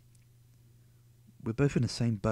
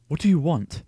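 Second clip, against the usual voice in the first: angry demand; 5 semitones higher, 10 dB louder than usual. Acted. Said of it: "He spits at me".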